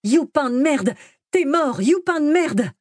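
high-pitched jeering cry; shouted taunt. A narrator's reading. Kid chases the fleeing boy, high menacing shout. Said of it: "en criant".

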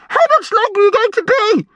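put-on urgent, high-pitched boy's demand; high agitated shout. A narrator's reading. Brian waiting outside the toilet, agitated. Brian pestering through the door.

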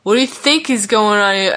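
loud, strained snarl at his friend; strained, hostile outburst; angry retort. A narrator's reading. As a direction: fire the question back, building to a scream. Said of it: "Vaughn glared".

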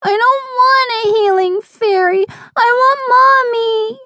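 put-on very high, whining child's sob; sobbing little girl. A narrator's reading. Little sister refuses fairy, wants her mother, high sobbing. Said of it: "Gabby sobbed".

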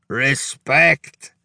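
put-on accented bellow, lifted at the end; Hungarian bellow. A narrator's reading. Drunk Hungarian face to face with Rudy, bellowing. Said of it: "the Hungarian bellowed".

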